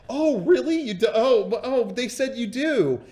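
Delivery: light, high voice of mild disbelief; surprised woman. Retold or imitated.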